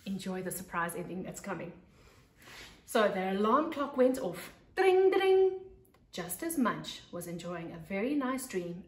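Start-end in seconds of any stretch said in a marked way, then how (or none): 2.9-4.5 put-on lively read-aloud voice for young kids; storybook narration. A narrator's reading. 4.8-5.6 high, loud ringing ding ding; alarm bell imitation. Alarm clock going off.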